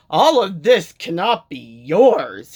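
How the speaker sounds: loud, slow protest; cried out. A narrator's reading. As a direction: cry it out in disbelief. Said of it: "he cried".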